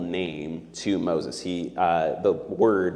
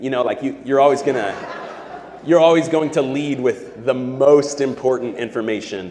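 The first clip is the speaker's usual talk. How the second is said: higher and much louder, pressing the point; emphatic build; 7 semitones higher, 8 dB louder than usual. Real, not acted. Preacher to congregation, pushing the lesson loudly. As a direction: drive it home with energy.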